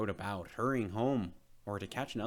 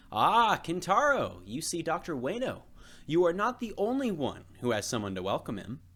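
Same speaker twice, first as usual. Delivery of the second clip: a lightly voiced happy papa calling out; proud father welcoming his boy; cheerful father; 6 semitones higher, 6 dB louder than usual. Papa greets son at station.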